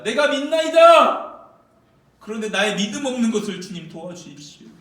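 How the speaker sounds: high, loud desperate cry; a father begging at the top of his voice. Shown as a demonstration. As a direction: cry out the plea in anguish. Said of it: "아마 이렇게 했을 겁니다".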